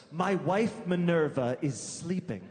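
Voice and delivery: ordinary talk, nothing marked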